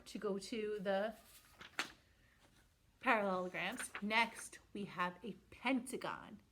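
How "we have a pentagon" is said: whispering. Acted. Whispered reveal.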